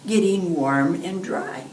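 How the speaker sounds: usual speaking voice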